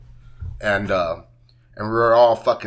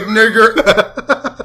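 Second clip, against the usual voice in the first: laughing retelling; 7 semitones higher, 5 dB louder than usual. Retold or imitated. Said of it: "I was like".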